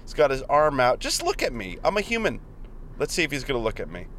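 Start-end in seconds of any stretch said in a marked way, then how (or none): 1.0-2.4 high-pitched, exasperated appeal to a driver; exasperated plea. Real, not acted. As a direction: plead to be noticed, not shouting. Pleading at a driver ignoring him.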